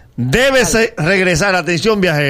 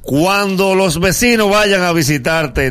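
normal talking voice

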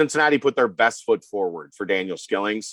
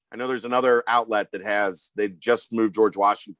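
ordinary talk

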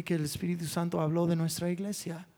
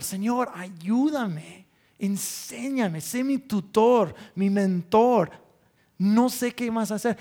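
slow, rising, louder pleading; urgent prayer building louder; 3 semitones higher, 3 dB louder, slower than usual. Retold or imitated. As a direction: plead with the Lord, stretching each word.

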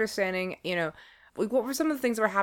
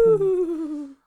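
spooky ooh; 11 semitones higher, 5 dB louder than usual. Acted. Mock spooky reaction.

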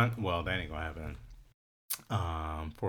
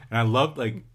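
ordinary talk

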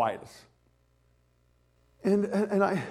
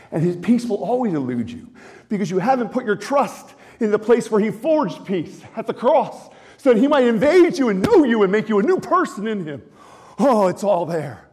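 raised, fervent preaching voice, slowed into the key line; preaching at full force; 7 semitones higher, 7 dB louder than usual. Real, not acted. Pastor to his congregation on Philippians, fervent preaching. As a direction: drive the scripture home to the room.

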